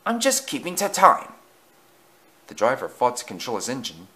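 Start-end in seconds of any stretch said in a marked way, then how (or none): 0.1-1.3 higher, louder retort; snappish. A narrator's reading. Easton defending his speed to the coaches, snappish. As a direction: snap back in self-defence. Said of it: "snapped Easton".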